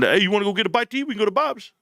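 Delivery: dad casually suggesting lunch; a hint of dad. Dad suggests eating at Bob's.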